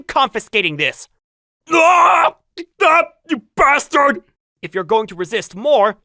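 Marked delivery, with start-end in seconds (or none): 1.7-4.2 loud, high furious yell; loud enraged protest; angry shout. Acted. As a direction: scream at him to stop. Fighting over the camera.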